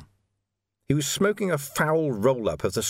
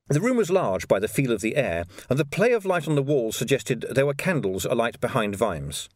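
usual speaking voice

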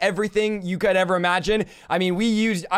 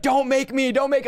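higher, pleading mock-demon voice; protesting demon; 4 semitones higher than usual. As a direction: imitate a demon begging not to. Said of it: "And the demon's like".

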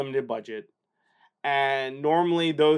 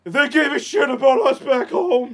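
higher, louder hammed-up Rambo line; mock movie speech; 8 semitones higher, 7 dB louder than usual. Friend quoting the First Blood ending, hammed-up. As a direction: overplay the dramatic movie line.

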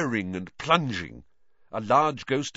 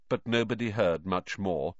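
ordinary delivery, nothing marked

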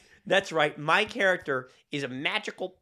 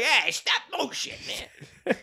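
mock Boston accent; 8 semitones higher than usual. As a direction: toss it off like a lazy Boston guy. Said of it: "has a Boston accent".

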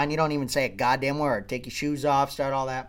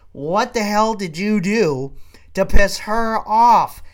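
drawn-out, higher, emphatic question; exasperated emphasis; 4 semitones higher, 6 dB louder, slower than usual. Retold or imitated. Husband trying to think what angered her, exasperated emphasis. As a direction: stretch every word out in exasperation.

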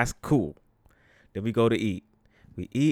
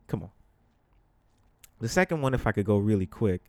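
normal talking voice

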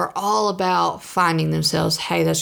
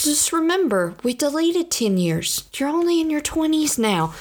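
put-on higher, gentle little-girl voice, soothing; sweet little girl; 10 semitones higher than usual. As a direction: pat the hand and reassure, sweetly. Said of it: "And then she goes".

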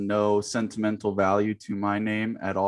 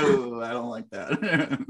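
laughing; slower than usual. Real, not acted. Laughing at an awkward memory.

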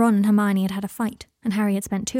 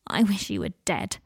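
usual speaking voice

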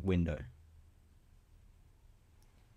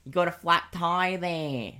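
hoog en traag Australisch; Australian accent; 6 semitones higher than usual. Acted. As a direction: een langzame, joviale Aussie. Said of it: "Australian guy".

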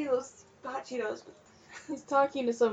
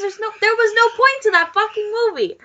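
much louder and higher, slow, laughing in places; exasperated rant; 8 semitones higher, 12 dB louder than usual. Real, not acted. Ranting to listeners about a bad movie, loud and exasperated. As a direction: vent at the pointless ending.

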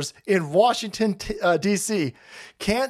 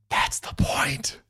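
slow, hushed whisper; 4 dB quieter, slower than usual. Real, not acted. Vlogger confides the real goal in a whisper. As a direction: drop to a secret whisper. Quiet aside in rant.

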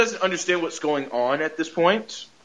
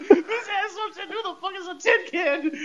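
very high squeal through laughter; laughing hard; 5 dB quieter than usual. Real, not acted. Podcaster repeating the insult to Vision, helpless high-pitched laughing. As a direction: squeeze the words out while cracking up. Repeats joke while cracking up.

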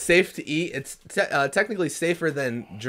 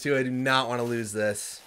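ordinary talk, nothing marked

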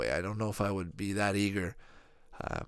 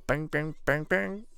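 bangs climbing higher and higher; imitated rapid dialing; 6 semitones higher, slower than usual. Showing viewers how people dial old phones fast, rising imitation. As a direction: rattle off bangs, each higher than the last.

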